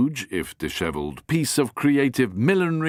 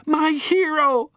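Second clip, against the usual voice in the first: high, slow, swooning; adoring Flapper; 3 dB louder, slower than usual. Acted. Flapper thinks of Harry, adoring. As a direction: sigh it adoringly.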